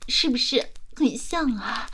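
ordinary talk

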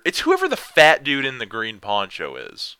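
ordinary talk

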